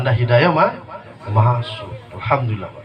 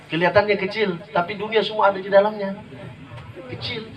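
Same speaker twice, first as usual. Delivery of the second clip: ordinary delivery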